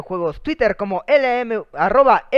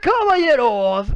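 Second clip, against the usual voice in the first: long drawn-out opening, then rapid patter; lucha libre announcer; 12 semitones higher, 8 dB louder, slower than usual. Acted. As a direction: stretch "Damas" like a ring announcer. Show opening, ring-announcer style.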